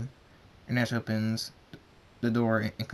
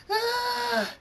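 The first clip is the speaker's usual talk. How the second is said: very high drawn-out scream; long thin high shriek; child's terrified scream. A narrator's reading. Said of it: "Rain screams".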